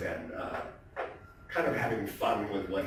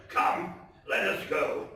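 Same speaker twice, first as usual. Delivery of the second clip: dramatic, theatrical storytelling voice; story narration; 7 semitones higher than usual. A narrator's reading. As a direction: read it like a gothic tale told by the fireside. Dramatic reading of Poe story.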